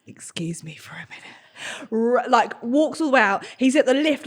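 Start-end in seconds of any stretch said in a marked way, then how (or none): 0.1-1.4 softer, lower whispered excuse. Retold or imitated. Sweating man whispers excuse at dinner table. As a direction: whisper it, desperate to escape. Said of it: "he just goes".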